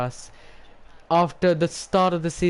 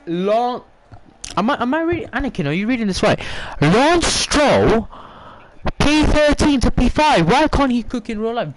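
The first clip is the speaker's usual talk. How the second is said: much higher and louder, incredulous shout; stunned disbelief; 9 semitones higher, 6 dB louder than usual. Real, not acted. Streamer shouting to Anakin in disbelief at the results, high and loud. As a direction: yell it like you can't believe the screen.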